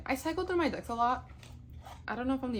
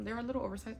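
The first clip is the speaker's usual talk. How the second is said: normal talking voice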